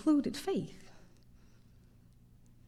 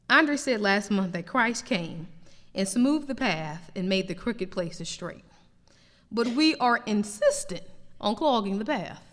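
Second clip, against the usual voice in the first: normal talking voice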